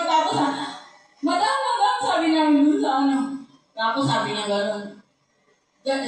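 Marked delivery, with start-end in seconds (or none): 1.2-2.0 alarmed calling. Retold or imitated.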